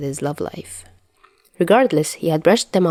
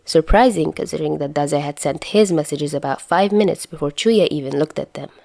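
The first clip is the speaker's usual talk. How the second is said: ordinary delivery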